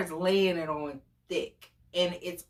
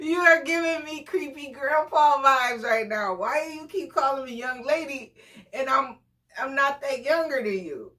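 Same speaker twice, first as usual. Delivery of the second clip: high, amused mock complaint; laughing protest; 7 semitones higher, 3 dB louder than usual. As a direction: object through laughter, pitched up. Laughing protest at date's remarks.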